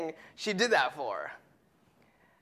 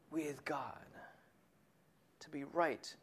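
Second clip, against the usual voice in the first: hushed; 4 semitones lower, 13 dB quieter than usual. Acted. Preacher hushes key phrase.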